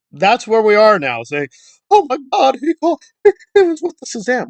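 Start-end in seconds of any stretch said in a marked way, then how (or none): 1.9-4.5 high, gasping mock-horrified voice; mock outrage. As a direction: parody the shocked crowd. Mocking outraged critics.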